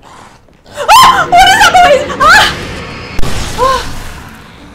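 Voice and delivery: very high, loud shriek of fright; terrified screaming. Real, not acted. Gamer startled by a noise in the game, screaming in terror. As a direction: scream out in real panic.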